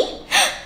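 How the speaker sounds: sharp high pretend gasp; mock gasp. Teacher showing the children surprise, high playful gasp. Reacting to feelings pictures.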